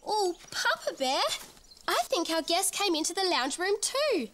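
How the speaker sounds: put-on baby bear voice.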